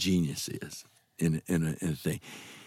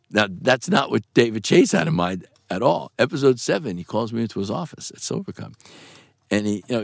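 ordinary talk, nothing marked

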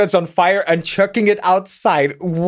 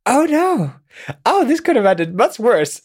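higher, startled "oh no"; alarmed character; 7 semitones higher than usual. Retold or imitated. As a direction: play a panicked character voice. Voicing character in retold scene.

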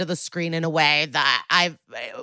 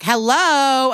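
higher, drawn-out mock hello; acted-out objection, pitched up and stretched; indignant interjection; 6 semitones higher, 4 dB louder than usual. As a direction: cut in with an incredulous call.

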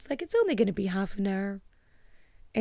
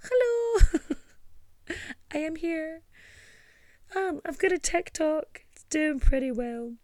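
awkward fangirl; 6 semitones higher than usual. Retold or imitated. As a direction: squeal it like an eager newcomer. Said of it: "showing up like".

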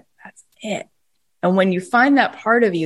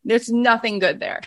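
normal talking voice

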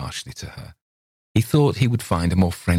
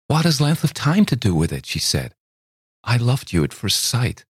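voiced higher, a hurt young lady; young woman pleading; 7 semitones higher than usual. A narrator's reading. Young woman asks Campbell why he avoids her, pleading. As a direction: reproach him gently, lifted and wounded. Miss Thayer answers Campbell.

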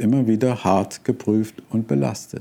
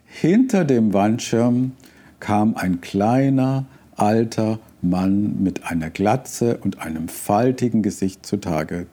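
normal talking voice

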